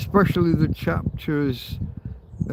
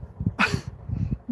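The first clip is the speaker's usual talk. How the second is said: tearful laugh; 6 dB quieter than usual. Real, not acted. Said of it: "I don't know why I'm crying".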